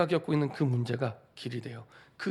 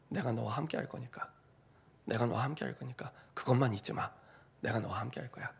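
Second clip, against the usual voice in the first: softer, gentle reassurance; God promising to stay with his child, soft and tender; tender promise; 3 semitones lower, 7 dB quieter than usual. Acted. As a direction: promise quietly, like comforting a child.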